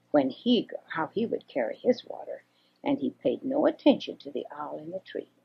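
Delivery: usual speaking voice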